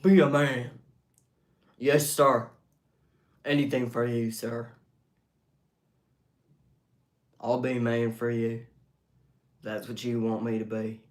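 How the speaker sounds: loud, level mock-macho delivery; mock manly routine. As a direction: bark it like a lightly played recruit. Performs a mock tough-guy routine.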